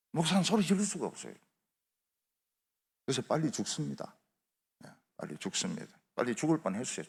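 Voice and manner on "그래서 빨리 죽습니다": ordinary delivery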